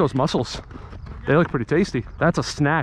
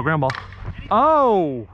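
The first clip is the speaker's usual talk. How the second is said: ordinary talk, nothing marked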